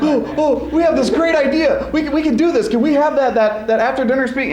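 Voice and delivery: high, giddy imitation of pleading; mock eager pleading. Host mimicking Sarah and Sam begging for the speech, giddy. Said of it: "Sarah and Sam were like".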